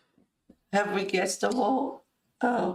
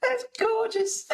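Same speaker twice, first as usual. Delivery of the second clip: laughing. Real, not acted. Laughing so hard.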